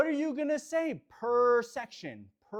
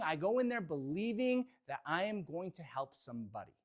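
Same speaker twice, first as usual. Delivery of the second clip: normal talking voice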